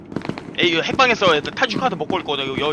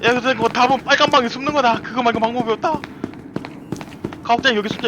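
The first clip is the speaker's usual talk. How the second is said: being chased; 7 semitones higher than usual. Real, not acted. Still fleeing, looking for a hiding spot.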